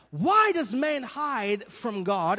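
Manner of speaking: normal talking voice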